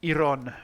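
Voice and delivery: IRON as EE-ron, punched; Finnish pronunciation. Shown as a demonstration. Returns to Finnish way of saying it.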